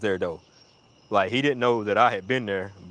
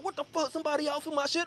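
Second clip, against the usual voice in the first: high, softened indignant voice; high-pitched hushed outrage; mock alarm; 7 dB quieter, faster than usual. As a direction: imitate a startled friend, pitched way up. Friend reacting to an intruder.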